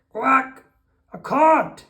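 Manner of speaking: loud, high snapping quack; high, loud indignant duck outburst; angry duck. A narrator's reading. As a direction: snap back as a cross duck. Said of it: "the quack of an angry duck".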